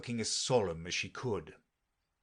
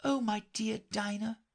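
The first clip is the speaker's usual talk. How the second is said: put-on high, forlorn girl's voice; melancholy; 12 semitones higher than usual. A narrator's reading. Alice alone, missing her cat, melancholy. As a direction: lament wistfully, pitched high. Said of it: "in a melancholy tone".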